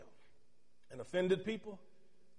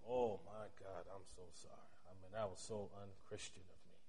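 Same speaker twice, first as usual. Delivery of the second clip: humble apology; 9 semitones lower, 14 dB quieter than usual. Retold or imitated. Pastor apologizing to the rude lady, soft contrite voice. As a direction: murmur sorry with real remorse.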